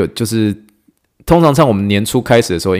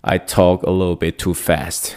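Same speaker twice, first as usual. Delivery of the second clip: slow, careful demo; slower than usual. Shown as a demonstration. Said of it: "我讲话速度确实有些些快".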